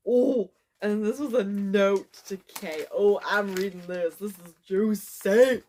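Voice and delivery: very loud, drawn-out delight; gleeful excitement. Real, not acted. Diarist finding an old note to a friend, loudly excited. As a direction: crow over a juicy discovery.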